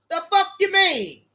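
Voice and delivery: normal talking voice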